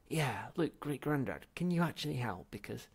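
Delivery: high, loud anxious asking; urgent plea. Acted. Zaphod begging his ancestor for help, anxious. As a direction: beg for help quickly.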